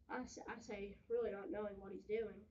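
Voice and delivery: ordinary delivery, nothing marked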